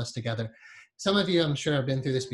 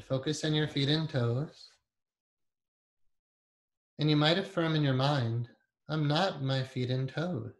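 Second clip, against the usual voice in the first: guided meditation. Acted. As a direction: ease them inward with long pauses.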